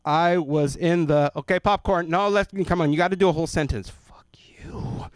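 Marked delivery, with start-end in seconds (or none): 1.3-3.9 put-on loud, high pushy kid voices; mock classmates pushing. Classmates loudly pushing him to read a whole sentence. 3.9-5.1 hushed, breathy whisper; whispered curse. Retold or imitated. Boy whispering a curse back at classmates.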